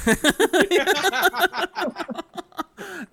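a long, high burst of laughter; a real, long, high laugh. Real, not acted. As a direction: crack up at the punchline. Laughs at the story.

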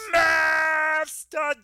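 very long, high mock cry; hammed-up bellow at a frightened stranger; doubled-down bellow. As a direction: wail it out high, stretching each syllable. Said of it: "[name] doubles down".

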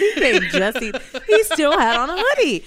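excited retelling. Retold or imitated. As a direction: belt it out in disbelief. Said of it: "I said".

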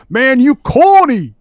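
high, loud drawn-out heckle from the crowd; heckler's yell. Retold or imitated. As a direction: yell it out at the stage. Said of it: "some guy just yells out".

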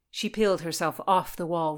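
ordinary delivery

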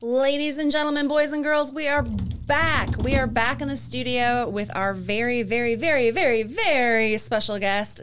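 booming emcee welcome, stretched out; grand entrance announcement. Acted. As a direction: announce it like a ring host. Show host announcing guest entrance.

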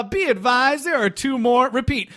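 high, stilted radio report; mock stilted radio call; awkward line reading. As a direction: read it stiffly like dubbed dialogue. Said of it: "that sounded so awkward".

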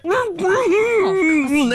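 high strangled humming; desperate muffled hums; choking hums. Retold or imitated. Choking woman voiced.

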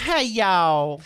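loud Southern Hey y'all; mock Southern greeting, thick twang; Southern accent. Teasing Tara with a Southern drawl. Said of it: "do a Southern accent".

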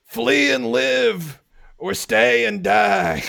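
slow, booming battle cry; commanding shout across a battlefield; shouted threat. Acted. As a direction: roar it out slowly to scare them off. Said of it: "so I shout again".

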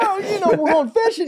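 put-on very high wailing kid voice; mock crying. Boy pleading to save the fishing trip, mock crying. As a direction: plead tearfully, hammed up like a child. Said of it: "I'm crying".

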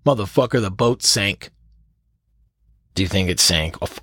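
usual speaking voice

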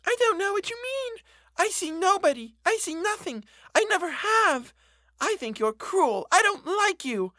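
put-on high, cold, pert child voice; a sulky girl rejecting her; pert little girl. A narrator's reading. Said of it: "vulgarly pert little girl".

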